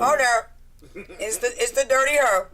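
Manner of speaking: loud, high parrot squawking insults; shrill cursing parrot voice. Retold or imitated. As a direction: play a rude parrot shouting at a guest. Parrot mocking a visitor.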